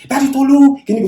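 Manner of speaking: high-pitched mock shout of a factory worker; imitated shouting. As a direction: call out as if over machinery, imitating noisy parents. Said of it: "they are always shouting".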